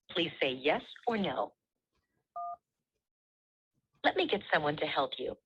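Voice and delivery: usual speaking voice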